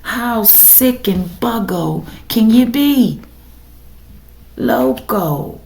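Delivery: ordinary talk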